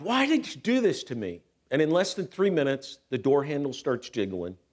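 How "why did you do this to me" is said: high, anguished retold cry; shout to God. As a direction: demand an answer from God. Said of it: "I shout to God".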